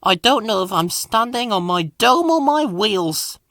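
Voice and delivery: high, weary little engine voice; sad. A narrator's reading. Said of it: "replied Percy sadly".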